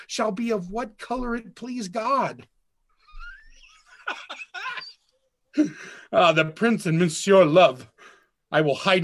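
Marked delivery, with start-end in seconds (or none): 4.1-4.8 high breathy laugh. Acted. Benedick laughs alone in garden.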